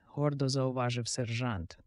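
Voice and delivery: usual speaking voice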